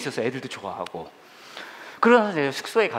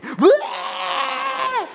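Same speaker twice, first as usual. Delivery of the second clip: put-on high screaming yell of excited children; kids' wild shout; 4 dB louder, much slower than usual. Pastor imitating kids screaming at camp. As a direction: scream like a crowd of hyped kids.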